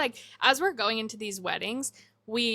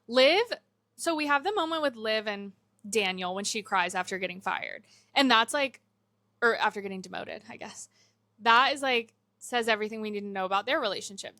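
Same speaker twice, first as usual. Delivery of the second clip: usual speaking voice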